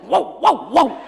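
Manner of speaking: loud, punched, repeated wows; acted burst of forced energy; imitating energetic man. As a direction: fire off each wow separately. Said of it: "show other people how energetic you are".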